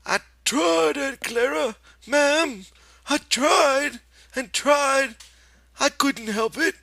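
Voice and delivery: put-on very high, loud, pleading childlike voice; a scolded child begging forgiveness; pleading Lenny. A narrator's reading. Lenny pleads with Aunt Clara, very high and childlike. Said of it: "And Lenny answered her".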